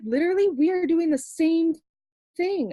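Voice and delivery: high thrilled insistence; excited. Retold or imitated. Telling the bracelet girl they match, high and thrilled. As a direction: gush that you match. Excited about shared cause.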